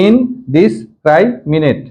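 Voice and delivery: ইনডিসক্রাইমিনেট slowly, split into its syllables. Shown as a demonstration. Teacher recaps the pronunciation for pupils, ইনডিসক্রাইমিনেট in slow separate syllables. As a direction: break ইনডিসক্রাইমিনেট up with short gaps between syllables. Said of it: "এইভাবে উচ্চারণ করবো".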